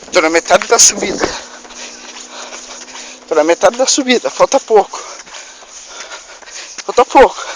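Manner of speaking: panting after an uphill run; gasping between words, legs aching; out of breath. Real, not acted. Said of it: "tô sem fôlego".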